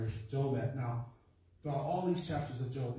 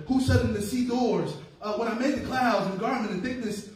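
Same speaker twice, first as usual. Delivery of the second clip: preaching at full force; 6 semitones higher, 7 dB louder than usual. Acted. Preaching God's answer at force.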